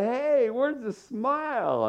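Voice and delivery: high-pitched, drawn-out coaxing; cheery encouragement. Retold or imitated. Pastor encouraging a child with attitude, cheery coaxing. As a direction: coax a smile out of a sulky kid. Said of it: "I was trying to encourage him".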